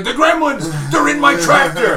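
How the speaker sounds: high, loud mock-panicked yell; alarmed shouting. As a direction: cry out in hammed-up alarm. Acts out drunk Murray's alarm.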